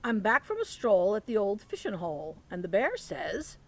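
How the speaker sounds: lively picture-book read-aloud; reading to children. Acted. Teacher reading Thanksgiving book to children, lively read-aloud. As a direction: read it like story time for little ones.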